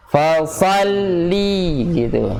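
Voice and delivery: "Fasalli" with the first a dragged long; wrong long reading. Shown as a demonstration. Teacher mimicking the common lengthening mistake. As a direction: overstretch the "fa" in "Fasalli". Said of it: "Seringnya suka dibaca panjang".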